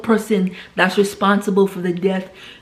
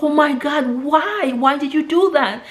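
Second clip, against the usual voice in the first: high, horrified disbelief; shocked disbelief; 6 semitones higher than usual. Retold or imitated. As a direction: gasp it out in horror, pitched up. Sister learns mother's crime.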